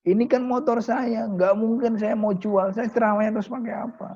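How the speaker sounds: cling to the motorbike in disbelief; alarmed refusal. Acted. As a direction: kiai refusing to give up his only ride. Poor kiai refuses selling motorbike.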